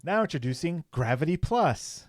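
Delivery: lightly put-on commercial pitch; mock ad announcer. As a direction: sell it like a spoof advert. Improvised parody subscription advert.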